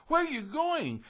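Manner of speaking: very high, breathless question; breathless gingerbread man. Acted. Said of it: "he asked breathlessly".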